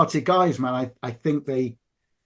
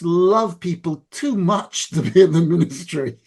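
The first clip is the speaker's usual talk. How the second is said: put-on Scottish accent; 3 dB louder than usual. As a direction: imitate a friend speaking in a Scottish accent. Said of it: "you do your Scottish accent".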